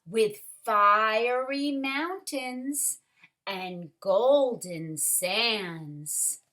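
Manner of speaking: put-on lively read-aloud for children; story reading. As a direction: read it like a picture book to the class. Story narration continues.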